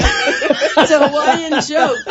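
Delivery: loud, slow words through laughter; laughing. Real, not acted. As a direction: laugh right through the line. Laughing at coconut joke.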